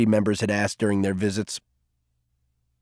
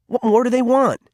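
put-on much higher, sharp frustrated teen; edgy; 11 semitones higher than usual. A narrator's reading. Cole frustrated with Garvey, higher and edgy. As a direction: push the question out with irritation. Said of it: "his voice edgy".

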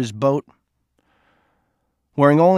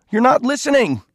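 much higher and louder, across water; screamed; 12 semitones higher, 4 dB louder than usual. A narrator's reading. Cole screaming at Edwin leaving by boat, loud. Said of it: "Cole screamed across the water".

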